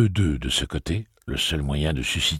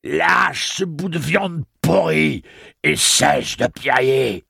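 high, loud, slow shouted order; hoarse shout; 12 semitones higher, 7 dB louder, slower than usual. A narrator's reading. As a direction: bark the order despite a torn throat. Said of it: "il hurla quand même".

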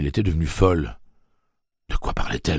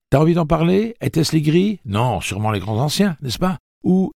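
questions fébriles; 14 semitones higher, 8 dB louder than usual. A narrator's reading. Eddie harcèle Blaine, désespéré.

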